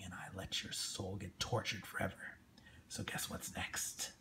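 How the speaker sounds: low, unvoiced whisper of a demon making a deal; menacing whisper. Demon whispering a deal to Chino, menacing and hushed. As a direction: breathe each threat softly, close to the mic. Reading Violator's menacing lines.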